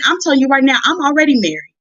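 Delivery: ordinary talk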